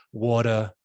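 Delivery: "water" with no R, ending in a vowel; New York pronunciation. Shown as a demonstration. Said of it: "Everything becomes an A or a vowel".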